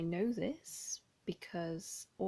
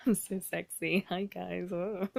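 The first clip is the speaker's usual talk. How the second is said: loud self-mocking joke; ironic, laughing at herself; sarcastic; 8 dB louder than usual. Real, not acted. Joking about her grim skin.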